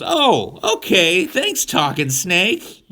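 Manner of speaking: very high, slow, easily won over; a simpleton's cheerful yes; gullible Adam. Retold or imitated. Adam giving in to the talking snake, high and slow.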